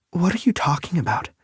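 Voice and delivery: Lake baffled. A narrator's reading. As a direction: ask as Lake, high and bewildered.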